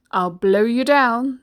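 slow, loud wolf threat; threatening wolf. Acted. Wolf answering the defiant piggy, slow loud menace. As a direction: draw the threat out with force.

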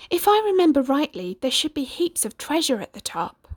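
put-on a curious boy plotting; young Jack. A narrator's reading.

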